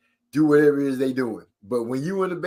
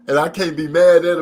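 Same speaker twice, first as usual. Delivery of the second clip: ordinary delivery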